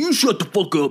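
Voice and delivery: high-pitched retort in a mock quarrel; imitated angry comeback; mock aggressive. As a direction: fire it back like a street idiot. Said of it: "just being aggressive and be like".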